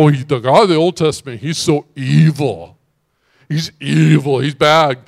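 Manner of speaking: loud, exaggerated complaint; mocking critics. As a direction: imitate critics, hammed-up and loud. Mimics critics of Old Testament God.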